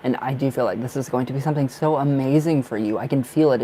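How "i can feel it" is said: ordinary delivery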